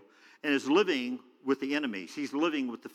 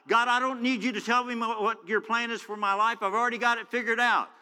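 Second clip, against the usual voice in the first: headstrong retort; 7 semitones higher than usual. Retold or imitated.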